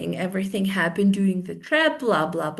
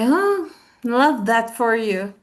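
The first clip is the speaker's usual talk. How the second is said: slow, drawn-out mock delight; sarcastic; 3 dB louder, much slower than usual. Shown as a demonstration. Replying to someone who won the lottery, sarcastic. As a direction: stretch the "oh" into sarcastic congratulation. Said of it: "I just wanna sound sarcastic".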